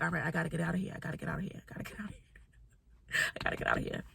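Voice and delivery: hushed, fading into a whisper; secretive muttering. Retold or imitated. Woman plotting to herself to get out of the club, hushed and fading to a whisper. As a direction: say it under your breath, repeating it down to a whisper.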